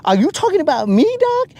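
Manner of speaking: fast, high, indignant; offended. Retold or imitated. Host challenging the alien, fast high mock offense. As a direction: rush it and squeak the last word, mock hurt. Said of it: "And I was like".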